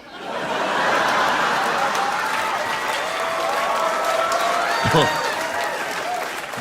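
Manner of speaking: wheezing laugh; high laughing burst. Real, not acted. Big laugh.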